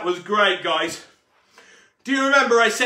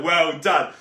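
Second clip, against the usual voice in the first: ordinary talk, nothing marked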